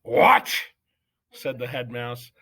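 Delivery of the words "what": outraged what. Acted. Judge astonished.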